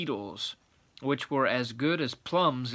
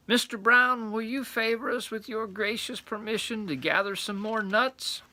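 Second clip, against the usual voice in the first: polite little squirrel; 8 semitones higher than usual. Acted. Squirrels politely ask owl.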